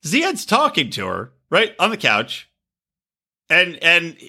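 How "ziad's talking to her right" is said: ordinary talk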